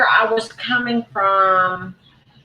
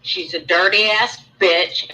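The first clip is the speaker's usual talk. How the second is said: usual speaking voice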